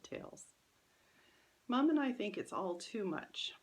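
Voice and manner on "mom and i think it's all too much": ordinary delivery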